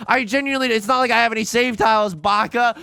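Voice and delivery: loud, high, flustered ranting; frantic outburst. Real, not acted. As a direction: keep ranting in a high panic. Still panicking, then finds a tile.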